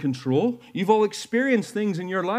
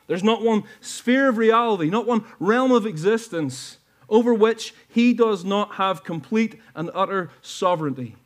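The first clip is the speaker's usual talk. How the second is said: louder, soaring preacher's cadence; impassioned preaching; 5 semitones higher, 3 dB louder than usual. Real, not acted. Preacher assuring his church their blessing isn't late, impassioned. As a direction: lift the congregation with conviction.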